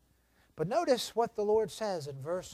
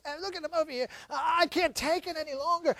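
mock complaining; 7 semitones higher, faster than usual. Israelites griping to one another, mock complaining. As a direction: complain in a raised, small voice. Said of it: "they did a lot of complaining".

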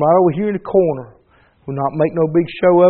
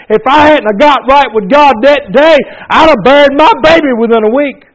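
loud, high fervent preaching cadence; preacher pouring it on to the congregation, full-force preaching; 5 semitones higher, 6 dB louder than usual. Acted. Pastor testifying to the church, fervent full-force preaching.

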